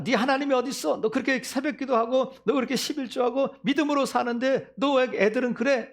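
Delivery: preacher mimicking a taunting scoffer; mocking taunt. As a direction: jeer at the believer's faith. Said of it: "막 그렇게 조롱할 때에".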